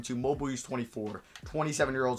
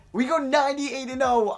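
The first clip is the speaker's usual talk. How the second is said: higher and louder, proud; triumphant cheer; 10 semitones higher, 6 dB louder than usual. Real, not acted. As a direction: announce it like a trophy win. Celebrating record.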